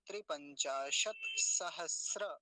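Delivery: slow त्रिपंचाशत सहस्र, bare t after शत; halant model. Shown as a demonstration. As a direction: pause on the t of त्रिपंचाशत, then sahas-ra. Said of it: "शत बोलेंगे क्योंकि तकार आधा है".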